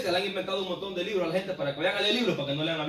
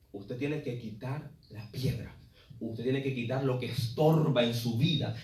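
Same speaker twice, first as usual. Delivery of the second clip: quiet plea; 10 semitones lower, 8 dB quieter than usual. Acted. Pleading with the congregation.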